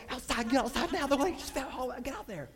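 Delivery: high-pitched urgent shouting; frantic yell. Retold or imitated. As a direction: drive the lifeguards out the door. Yelling at lifeguards.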